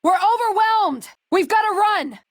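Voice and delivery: high, frantic yell to retreat; panicked shout. Acted. Companion overwhelmed in battle, panicked yell to flee. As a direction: scream for the squad to run.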